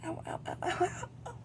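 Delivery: sharp cries of real pain; wincing ow ow ow. Real, not acted. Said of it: "Apparently my shoulders hurt too".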